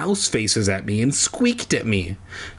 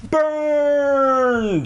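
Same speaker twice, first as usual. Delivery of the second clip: taunting burn. Acted. A reader shouting his own mom joke as a high taunt. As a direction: crow it like a schoolyard taunt. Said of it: "self joke, taunt".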